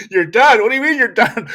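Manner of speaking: loud, high disbelieving question; incredulous. Retold or imitated. Server loudly questioning the customer, incredulous. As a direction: toss it back in loud disbelief. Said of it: "I was like".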